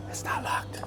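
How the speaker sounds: excited gasp then whisper; breathless sneaky whisper. Real, not acted. Sneaking into empty restaurant.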